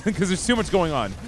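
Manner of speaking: normal talking voice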